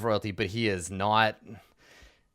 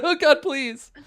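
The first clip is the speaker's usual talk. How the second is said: high, loud, hammed-up pleading; terrified begging; 12 semitones higher, 5 dB louder than usual. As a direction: grovel and beg for your life. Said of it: "puts his forehead on the ground".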